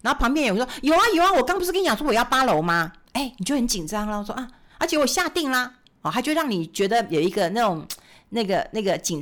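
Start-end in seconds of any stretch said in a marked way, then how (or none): 0.8-2.9 eager shill. Retold or imitated. Planted buyer claims eighth floor.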